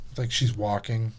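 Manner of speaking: usual speaking voice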